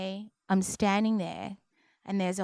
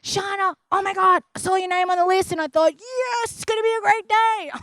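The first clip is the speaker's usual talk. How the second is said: high, loud, thrilled greeting; excited anesthesiologist; 11 semitones higher, 7 dB louder than usual. Retold or imitated. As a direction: imitate an overjoyed doctor hailing a patient. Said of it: "He was more excited than I was".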